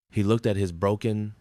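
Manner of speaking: ordinary delivery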